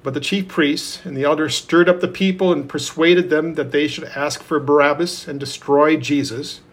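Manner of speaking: usual speaking voice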